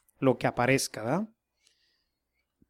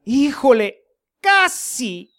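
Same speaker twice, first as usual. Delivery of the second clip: sad lament; 5 semitones higher, slower than usual. Retold or imitated. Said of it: "Pero qué triste diría".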